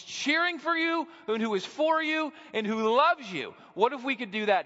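fiery pulpit declaration on fathering; full-force preaching. Acted. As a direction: proclaim it like a revival preacher.

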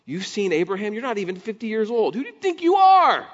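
rising to a high, loud cry; outraged disbelief. Retold or imitated. Temple leaders outraged at Jesus, climbing to a shout. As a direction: build from scoff to outraged shout. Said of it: "In response".

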